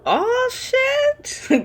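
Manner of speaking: a very high, stretched shocked cry. Real, not acted. Commentator hearing the ex reversed the car, squealing in shock. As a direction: squeal it in disbelief.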